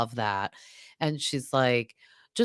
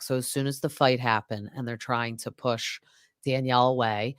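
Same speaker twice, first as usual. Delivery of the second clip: usual speaking voice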